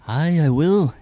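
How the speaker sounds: loud, high Irish captain voice; Irish accent. Acted. Game master answers a player as the captain, Irish accent. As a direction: belt it out as an Irish ship's captain. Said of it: "you're gonna do an Irish accent for the captain".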